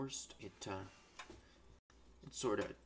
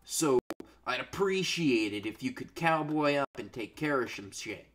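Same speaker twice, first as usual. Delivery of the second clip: loud rant; 8 dB louder than usual. Real, not acted. Ranting at the colonist.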